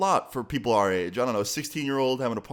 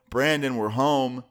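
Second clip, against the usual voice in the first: mild drawn-out call into the house; slow, lightly projected call; 3 semitones higher, 4 dB louder, slower than usual. Retold or imitated. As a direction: announce yourself on coming home. Dad calling out on arrival.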